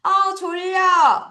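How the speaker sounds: high, drawn-out "I'm sleepy" prompt; big sleepy exclamation. Shown as a demonstration. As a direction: call it out big and stretched for the class. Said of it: "크게 말해보겠습니다".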